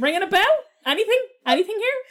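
high, teasing sing-song prompting; mock prompting. Teasing imitation, repeated prompting.

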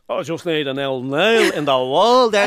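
slow, put-on working-class Dublin builder voice; mock builder accent. Talking to the builder at home, slow put-on Dublin builder accent. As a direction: drop into the tradesman's accent to fit in. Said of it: "when a builder comes around".

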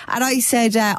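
ordinary talk

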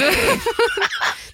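squeaky giggle breaking into her own quote; high burst of laughter. Real, not acted. Storyteller cracking up at her own retort.

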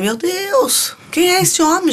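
high astonished gasp of wonder; jaw-dropped, pitch shooting up; amazed. Retold or imitated. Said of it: "eu fiquei de queixo caído".

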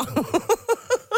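high-pitched real laughter. Real, not acted. Host laughing at listener story.

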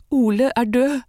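high, sobbing outburst of grief; sobbing cry. A narrator's reading. Sofie telling friends her sweetheart died, sobbing. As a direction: blurt it out through a sob. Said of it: "hikstet Sofie".

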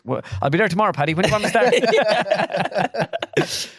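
high, eager, laughing by the end; comic sudden keenness, breaking into laughter; retold eager acceptance. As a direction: leap at the offer, voice climbing. Caving instantly to job offer.

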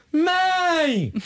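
high whiny call for mom. Acted. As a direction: yell "Mãe!" like a needy kid. Cancer sign calling for mom.

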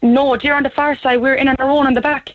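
ordinary talk, nothing marked

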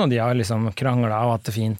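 normal talking voice

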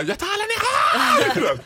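high, slow laughing shout; greedy shout. Retold or imitated. Desperate smoker shouting to the cashier, high and slow. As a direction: grab everything, shouting. Desperate buying all packs.

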